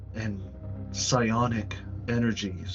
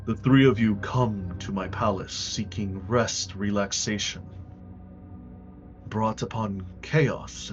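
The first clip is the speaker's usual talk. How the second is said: regal voice; 6 semitones lower, 13 dB quieter than usual. Acted. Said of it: "With his regal voice, he speaks".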